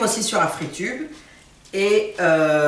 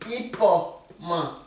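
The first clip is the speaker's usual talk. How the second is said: "Ipoman" drawn out slowly, each syllable clear; slow teacher model of "Ipoman"; slow careful; much slower than usual. Shown as a demonstration. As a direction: stretch out "Ipoman" so learners hear every sound. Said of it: "de la sonorité et de la phonétique".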